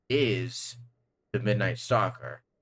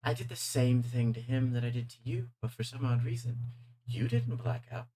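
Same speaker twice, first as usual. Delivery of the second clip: a purring, amused British-tinged demon, softer than the narration; sly villain voice; 4 semitones higher, 6 dB quieter than usual. Acted. Game master voicing the demon greeting the sheriff, silky and mocking. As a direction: savor every word like a smug villain.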